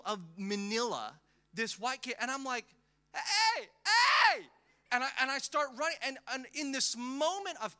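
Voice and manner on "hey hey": high, loud "hey, hey" shouted after a car; calling out. Retold or imitated. Boy calling after his mother driving away, shouting. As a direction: call after the departing car.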